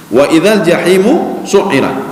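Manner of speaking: slow, chanted Arabic verse; Quran recitation. Acted. Preacher quoting the verse to the congregation, slow chanted recitation. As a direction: recite the verse slowly and melodiously.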